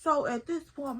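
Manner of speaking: ordinary talk, nothing marked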